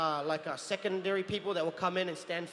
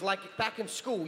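usual speaking voice

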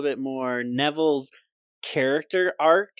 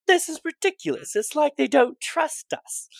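much higher, louder put-on whine of a teen boy; high whiny Ron; 5 dB louder than usual. S0 retelling Ron complaining to Hermione, high whiny voice. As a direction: squeak out Ron's grumbling complaint.

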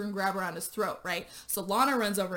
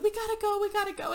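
a lot higher, hurried mock urgency; urgent; 11 semitones higher, faster than usual. Dax and Lana urging Shane to flee, high and urgent. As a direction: hustle them out the door.

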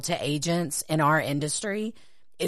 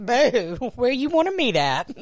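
high, loud flirty sass; flirty sassy voice; 5 semitones higher, 4 dB louder, slower than usual.

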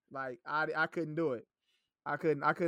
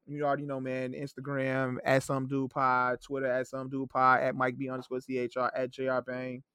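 normal talking voice

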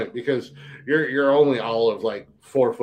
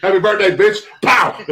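higher, louder mock punchline; gleeful birthday taunt, louder and high; 7 semitones higher, 6 dB louder than usual. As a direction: shout it like landing a punch. Punch joke.

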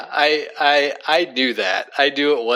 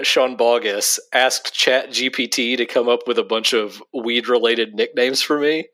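ordinary talk, nothing marked

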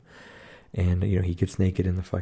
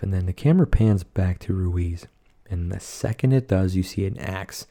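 usual speaking voice

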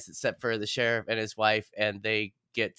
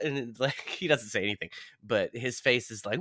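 ordinary delivery

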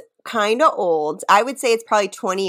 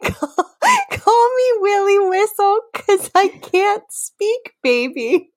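laughing; 12 semitones higher than usual. Real, not acted. Laughing through lyric line.

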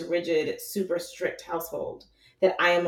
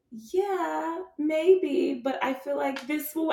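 mock reply; 9 semitones higher, slower than usual. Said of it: "And I'm like".

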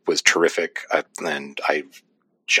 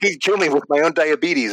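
much higher, put-on voice of a starstruck guy; mock caller voice; 10 semitones higher than usual. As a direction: squeak it out as a gushing fan. Mocking the caller's reaction.

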